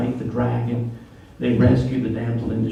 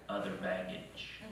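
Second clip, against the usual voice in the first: ominous confirmation; 7 semitones lower, 15 dB quieter, slower than usual. Retold or imitated. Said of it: "wife answering, grave".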